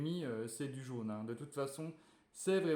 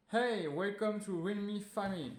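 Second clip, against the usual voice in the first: put-on higher, chirpy welcome in a French-English accent; 6 semitones higher, 3 dB louder than usual. Youtuber greets viewers in a mock English accent. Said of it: "Excusez-moi pour mon accent anglais".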